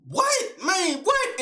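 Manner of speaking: a high, incredulous "What?"; stunned outrage, voice leaping; retold disbelief. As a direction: jump up in pitch in shock. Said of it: "I'm like".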